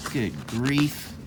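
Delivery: usual speaking voice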